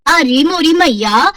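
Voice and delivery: slow, drawn-out dismayed exclamation; dismayed cry. Acted. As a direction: groan it out in mock dismay, stretching मैया up. Vacuum cleaner suddenly stops. (धीमी, खिंची हुई घबराहट)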